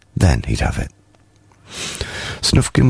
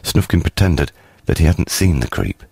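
usual speaking voice

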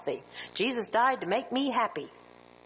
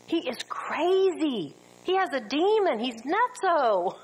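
slow, higher scornful jeer; mocking accusers; 5 semitones higher, 3 dB louder, slower than usual. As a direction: drag out the insult like a sneering critic. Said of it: "Jesus himself was called crazy".

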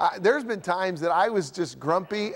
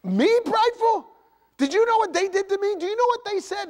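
hammed-up high indignant protest; 13 semitones higher than usual. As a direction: squeal in wounded self-justifying outrage. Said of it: "I'd be like".

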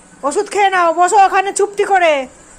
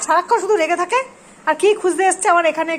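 ordinary talk

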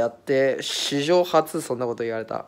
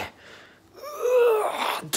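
drawn-out wail; much slower than usual. Real, not acted. Flustered on losing his thought.

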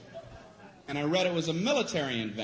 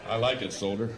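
gruff general; 7 semitones lower than usual. Acted. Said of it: "It's a general talking".

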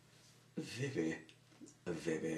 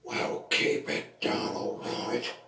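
loud, slow rasping order; shouted telling-off; 7 dB louder than usual. Acted. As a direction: shout it in a voiceless rasp. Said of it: "No need to shout".

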